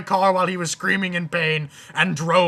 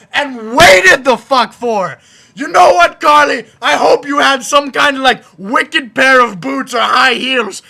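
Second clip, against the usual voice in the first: furious ranting vlogger; angry rant; 6 semitones higher, 6 dB louder than usual. Real, not acted. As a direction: vent your anger at everything. Rants angrily throughout.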